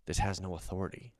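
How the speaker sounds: low, soft dismissal of the badge; quiet renouncing. Thief renounces self, soft and low. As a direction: set it down quietly. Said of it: "he goes".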